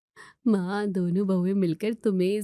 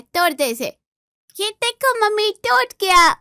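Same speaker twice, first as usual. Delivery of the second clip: put-on much higher and louder, a kid's triumphant cry; gleeful child; 11 semitones higher, 7 dB louder than usual. Little girl crying out to her mother after smashing the showpiece, gleeful squeal. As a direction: shriek it like a naughty kid proud of the mess.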